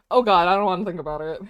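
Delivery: ordinary talk, nothing marked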